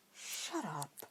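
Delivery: a soft, embarrassed mutter. A narrator's reading. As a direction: mumble it, flustered and blushing. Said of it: "Harry muttered with a blush".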